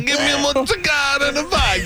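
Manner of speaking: mock Russian accent. S0 joins the joke in a mock Russian accent. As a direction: order it in a silly put-on Russian accent. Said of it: "like a Russian".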